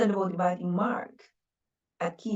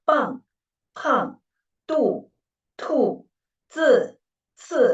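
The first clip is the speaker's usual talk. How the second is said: "Bang, pang, du, tu, zi, ci" each starting high and falling sharply; emphatic fourth tone; 5 semitones higher, 6 dB louder, slower than usual. Shown as a demonstration. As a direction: hit each syllable with energy, pitch dropping. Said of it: "use our energy and make the emphasis".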